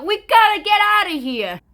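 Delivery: high, loud cry to flee; urgent mock shout. Separatists out of jail rallying to flee, urgent shout. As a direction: call out a dramatic escape. Acted escape from England.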